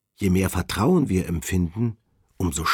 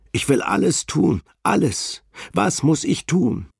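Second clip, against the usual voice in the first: desperate plea; 7 semitones higher than usual. Acted. Harry clinging to the ledge begs the Lord, desperate and pitched up. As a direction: beg as if about to fall. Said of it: "rief Harry".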